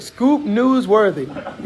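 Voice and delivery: drawn out, slow mocking repeat of his words; mocking echo. Real, not acted. Host mocking his co-host's brag, slow and teasing. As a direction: stretch it out to make fun of him.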